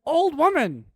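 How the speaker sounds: high, loud cries of shock; acted outburst of disbelief; screaming wife. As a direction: shriek each word separately, falling at the end. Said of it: "And she said".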